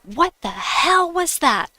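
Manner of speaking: high, loud furious demand; angry outburst. Retold or imitated. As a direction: snap it out like an angry coworker. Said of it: "she was so angry".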